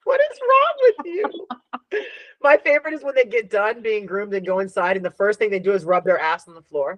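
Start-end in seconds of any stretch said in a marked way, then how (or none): 0.0-2.0 high, disbelieving outcry; mock outrage. Real, not acted. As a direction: squeal it at yourself in disbelief. Laughing at her own quotes.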